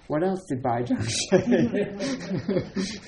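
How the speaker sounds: slow, high, laughing through the question. Retold or imitated. Yao Shan pressing his attendant, laughing as he asks.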